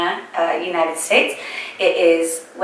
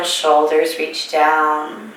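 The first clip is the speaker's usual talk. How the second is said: slow exhale cue; much slower than usual. Shown as a demonstration. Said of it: "exhale cue drawn out".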